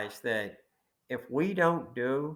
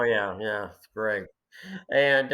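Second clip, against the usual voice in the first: usual speaking voice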